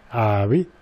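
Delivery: usual speaking voice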